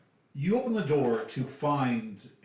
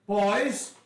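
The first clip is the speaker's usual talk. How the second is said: louder, higher summoning call; calling the guards; 9 semitones higher, 5 dB louder than usual. Acted. Lady Drana calls her half-orc guards, loud and commanding. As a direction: call the boys over with authority. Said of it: "You hear".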